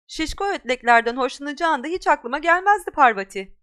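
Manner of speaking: Pansy mocking Parvati's loyalty; sneering taunt. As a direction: jeer at her. Mean girl mocking Parvati.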